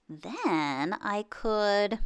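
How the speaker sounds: slow, musing half-thought; slow pondering. Acted. Earl thinking aloud while shaving, slow pondering. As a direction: drag it out as if still working it out. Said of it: "Earl thought while he bought cotton".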